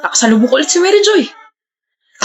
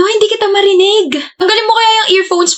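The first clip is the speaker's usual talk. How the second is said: shouting over earphones; 8 semitones higher than usual. Acted. Calling over sister's earphones.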